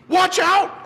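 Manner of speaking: high, loud warning cry; shouted warning. Acted. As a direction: yell a sudden warning. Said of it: "Ernie shouted".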